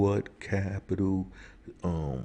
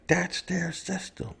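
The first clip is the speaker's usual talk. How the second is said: much higher, near-cry of outrage; peak indignation; 14 semitones higher than usual. Real, not acted. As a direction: hit it even higher in disbelief. Repeat, rant peaks.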